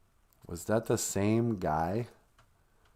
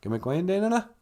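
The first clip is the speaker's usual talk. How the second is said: "Gimmick Wayne Dane Enna" run together as one quick question; "Gimmick Wayne Dane Enna" in one connected flow; smushed together; 8 semitones higher, faster than usual. Shown as a demonstration. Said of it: "just smush it together and say".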